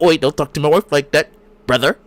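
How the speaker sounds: hammed-up Canadian telling-off; mock Canadian accent. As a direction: play a protective husband with a joke Canadian accent. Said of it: "or however can, Canadian people speak".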